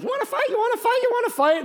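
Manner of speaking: squeaky high little-guy taunt; little guy challenge. Retold or imitated. Micah as a little guy challenging the army, squeaky taunt. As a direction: yap it like a tiny dog picking a fight. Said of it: "Little guy like".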